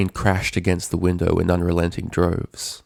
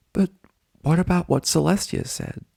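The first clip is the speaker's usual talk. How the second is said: put-on higher, tentative kid voice; hesitant Spike; 11 semitones higher than usual. A narrator's reading. Spike hesitantly reminding Twilight of Celestia's words. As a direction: ask it carefully, unsure.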